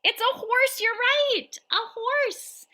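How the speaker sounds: high storytime voice; reading to a toddler. Acted. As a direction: ask it like to a toddler. Reading to little kids.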